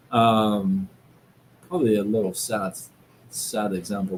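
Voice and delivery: normal talking voice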